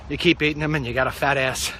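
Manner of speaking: ordinary talk, nothing marked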